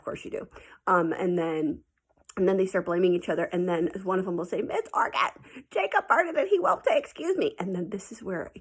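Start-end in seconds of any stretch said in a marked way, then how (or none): 4.6-7.5 put-on whiny tattling kid.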